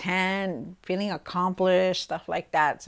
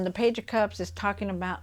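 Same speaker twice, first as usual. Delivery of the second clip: ordinary delivery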